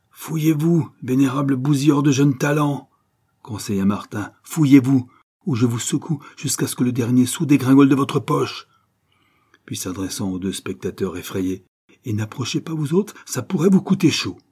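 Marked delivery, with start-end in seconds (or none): 0.1-2.9 raised, high-pitched angry order; angry command. A narrator's reading. Martin shaking the manager by the throat, furious. 4.4-8.7 loud, high menacing order; angry threat. A narrator's reading. Martin threatening to shake out every penny, furious. 12.0-14.4 high warning, growing louder; threatening warning. A narrator's reading. Martin warning two frightened onlookers, menacing.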